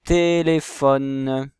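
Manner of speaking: Téléphone drawn out, té-lé-phone, each é clear; slow syllabified demo. Shown as a demonstration. Teacher to pupils, slowly sounding out the word. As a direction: stretch Téléphone into three slow loud syllables. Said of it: "Est-ce qu'on entend le et dans téléphone".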